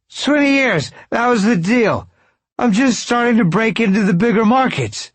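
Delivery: put-on loud, very high protest from a drunk; squeaky drunk, indignant; high-voiced drunk. A narrator's reading. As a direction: plead your case in a high, loud drunken voice. Drunk Mike protests in his voice.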